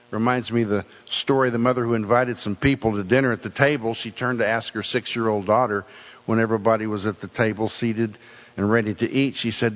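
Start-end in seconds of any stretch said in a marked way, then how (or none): none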